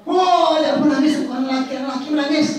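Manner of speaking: high, loud acted amazement; mock astonishment. Storyteller reacts to the father crying, loud high mock astonishment. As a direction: exclaim at a grown man in tears. Mocking the father who cries.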